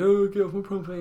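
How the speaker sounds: mock grumpy fifty-year-old shouting from his window; grumpy old man shouting. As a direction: yell it out the window like a grumpy pensioner. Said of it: "shouting from my fucking window".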